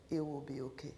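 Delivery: soft, hushed comfort; gentle reassurance. Retold or imitated. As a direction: soothe a crying student quietly. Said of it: "put her hand right on my back".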